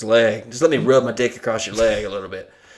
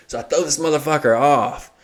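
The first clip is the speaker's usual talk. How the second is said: ordinary delivery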